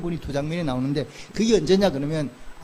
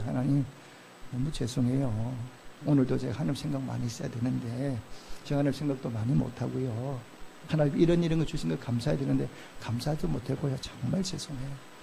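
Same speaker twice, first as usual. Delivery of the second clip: humble prayer; 5 semitones lower, 7 dB quieter than usual. Retold or imitated. Preacher voicing his own prayer, soft and contrite. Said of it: "그래서 제가 그래도".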